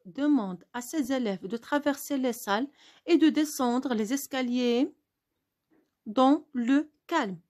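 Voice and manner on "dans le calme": "dans le calme" read very slowly, word by word; slow it down like a dictation; dictation pace. Shown as a demonstration. Teacher dictating to pupils, very slowly. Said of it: "cette dictée".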